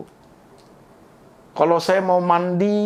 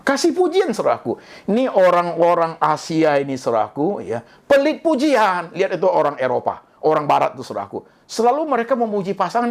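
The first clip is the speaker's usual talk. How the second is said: normal talking voice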